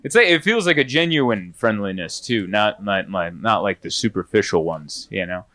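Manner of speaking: ordinary talk, nothing marked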